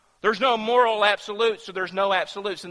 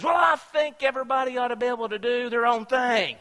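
put-on higher and louder, a shouted defiant teen; mock defiant son; 5 semitones higher, 3 dB louder than usual. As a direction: toss it off like a stubborn kid. Son states his ethic.

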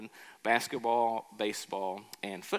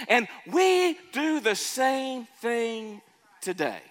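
slow, swelling preacher's voice; preaching; 7 semitones higher, 4 dB louder than usual. Acted. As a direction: lean into it like a pulpit sermon. Preacher builds on false gods.

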